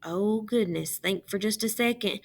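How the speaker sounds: ordinary delivery, nothing marked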